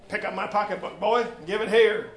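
scolding matriarch; stern large woman. Acted. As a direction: order the boy like a stern mother. Stern woman orders thief.

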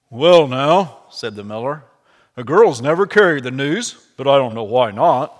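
lightly voiced hearty old miller. Miller answering Obadiah, lightly voiced hearty old man.

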